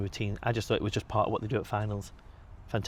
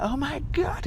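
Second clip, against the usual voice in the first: squealed, much higher; overjoyed squeal at an old friend; excited shriek; slower than usual. Retold or imitated. Liam excitedly greets him.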